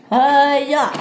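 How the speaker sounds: loud, high karate-style cry; effortful yell. Acted.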